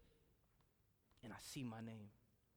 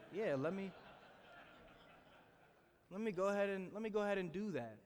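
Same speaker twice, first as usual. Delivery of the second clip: sarcastic giving in; 6 semitones higher than usual. Retold or imitated. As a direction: play along, dripping sarcasm.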